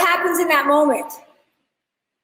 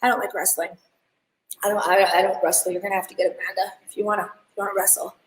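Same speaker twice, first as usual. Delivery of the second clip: lower, softer mock whine about wrestling; whiny refusal; 7 semitones lower, 5 dB quieter, faster than usual. Fighter refusing to wrestle, lightly mocked in a low soft voice. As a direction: lightly play a fighter ducking the hard part. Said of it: "he sits there and goes".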